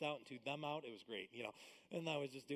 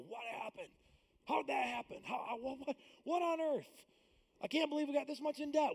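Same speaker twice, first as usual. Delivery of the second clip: high, loud, bewildered panic; lightly mock frantic disbelief; panicked person; 10 semitones higher, 4 dB louder than usual. Couple shocked by debt, high mock panic.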